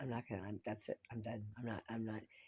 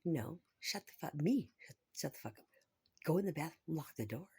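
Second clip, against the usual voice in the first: louder, higher self-scolding; mock angry rant; 6 semitones higher, 6 dB louder than usual. Telling herself off for leaving the bathroom unlocked, mock angry. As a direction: bark orders at yourself in mock fury. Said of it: "It's like".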